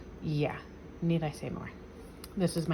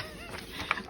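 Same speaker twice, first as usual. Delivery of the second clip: a short high giggle, caught off guard; light embarrassed laugh; 9 dB quieter than usual. Real, not acted. Son's artwork in her book.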